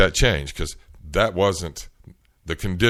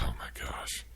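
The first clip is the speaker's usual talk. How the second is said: breathy whisper under the breath; hushed oh my gosh; whispered dread; 7 semitones lower, 14 dB quieter than usual. Retold or imitated. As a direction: whisper it in quiet despair. Inner reaction to the agent.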